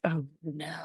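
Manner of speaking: quiet dread. Retold or imitated. Facilitator dreads the heckler speaking first, hushed low dread. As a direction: sink into a dreading mutter.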